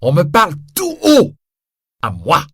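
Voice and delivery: loud high outrage; indignant shout. Acted. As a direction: shout in offended pride. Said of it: "Répond Javert".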